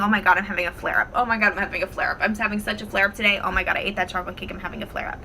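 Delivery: rapid, rattled-off complaint; repeating over and over. Acted. Coach mimicking someone fixated on their IBS flare up, rapid repetitive complaining. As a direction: reel it off fast, one repeat after another. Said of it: "over and over and over and over again".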